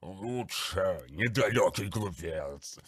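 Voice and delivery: slow, gurgling, contemptuous beast; gurgling monster voice. Acted. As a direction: sneer like a bloated daemon. Grulgar the monster speaks. (булькающий голос чудовища)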